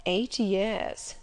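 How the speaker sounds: mildly astonished master; astonished echo. A narrator's reading. Master repeats the governess's answer, mildly astonished. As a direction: echo it back with a touch of disbelief.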